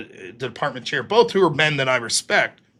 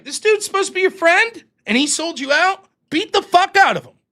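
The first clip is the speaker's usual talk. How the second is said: high, loud outrage on a friend's behalf; worked-up, high-pitched retelling of dad's rant; indignant dad; 13 semitones higher, 5 dB louder than usual. Retold or imitated. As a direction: egg him on like a fired-up father. Acting out dad's indignant advice.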